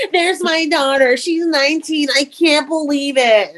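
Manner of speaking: high, gushing proud coo; doting proud mom. Retold or imitated. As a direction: brag about her to the whole room. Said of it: "She's sitting right there".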